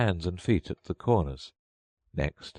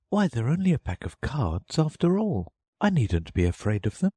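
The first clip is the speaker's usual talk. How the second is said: Alice to herself; 8 semitones higher than usual. A narrator's reading. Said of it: "she added to herself".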